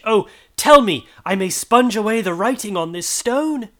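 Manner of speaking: high, loud anguished promising; agonized vow. A narrator's reading. Scrooge vowing to honor Christmas to the spirit, anguished and loud. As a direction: vow it in desperate agony. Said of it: "In his agony".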